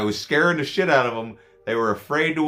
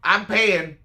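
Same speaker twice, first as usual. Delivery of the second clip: higher, brash mock drunk; drunk old lady; 6 semitones higher, slower than usual. Imitating the drunk lady waving off security. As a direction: toss it off like a careless drunk. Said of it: "she's like".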